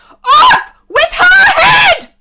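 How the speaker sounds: full-force high scream; enraged royal bellow; shouting at top of voice. A narrator's reading. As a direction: shriek the order, stretching each word. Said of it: "shouted at the top of her voice".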